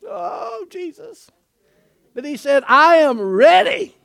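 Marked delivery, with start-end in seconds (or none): 2.7-4.0 bold declaration. Acted.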